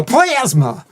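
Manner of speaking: high, stretched plasma with a flat a; exaggerated posh plasma. Shown as a demonstration. Cohost teaching the memory trick, hammed-up posh plasma. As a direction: ham up plasma like a pompous aristocrat. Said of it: "the way to remember it is to go".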